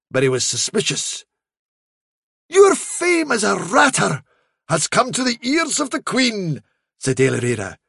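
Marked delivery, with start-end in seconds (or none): 2.5-6.6 high ceremonial herald; grand fairy messenger, flattering. Acted. Trickster flatters the hound as famed ratter, grandly. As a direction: announce it like a royal honour.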